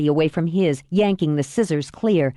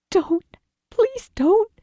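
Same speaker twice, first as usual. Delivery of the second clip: very high, desperate daughter; anguished pleading; much slower than usual. Acted. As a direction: beg your father in anguish. Daughter begs dying father.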